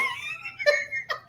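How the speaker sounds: high squealing laugh. Real, not acted. Laughing after emotional thanks.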